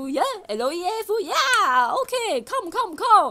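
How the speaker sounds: hammed-up salesman beckoning "come, come"; mock salesman luring a tourist to his stand; imitating the salesman. Salesman waving him over.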